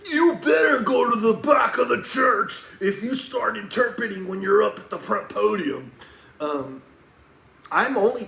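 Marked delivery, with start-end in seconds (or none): 0.1-5.9 preacher shout. Retold or imitated. Warning Christians who interpret the Bible, preaching in a shout. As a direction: shout it high and loud, like a stern preacher. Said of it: "when you see me being like".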